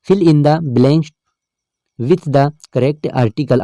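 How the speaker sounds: each word read out slowly for the class; slow reading aloud. Shown as a demonstration. Teacher reads the question slowly to the students. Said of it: "teacher reads the question aloud".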